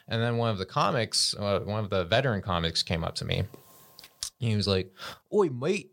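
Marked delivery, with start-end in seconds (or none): 5.3-5.9 mock British accent. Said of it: "he's British".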